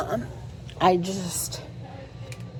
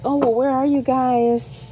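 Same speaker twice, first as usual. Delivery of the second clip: higher, slow, calling question; sister on the phone; 8 semitones higher, 4 dB louder, slower than usual. Retold or imitated. Sister asking over the phone where they are, higher, calling.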